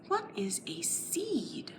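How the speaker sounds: a curious, breathy whisper. Acted. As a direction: wonder it aloud in a whisper. Teacher wonders aloud to kids.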